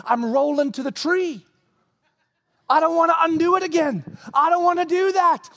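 high, pleading voice of a frightened human; panicky self as a human. Acted. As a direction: beg to be spared from yourself. Playing himself pleading with God.

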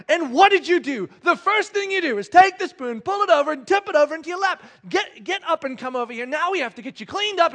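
high, loud exasperated rant; acted parental telling-off; scolding dad. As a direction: rattle off the child's offence angrily. Dad keeps scolding his son.

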